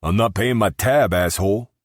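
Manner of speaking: put-on a drunk customer grumbles at the bouncer; grumbling patron. A narrator's reading. Grumbling drunk refusing to pay. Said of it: "one of them grumbled".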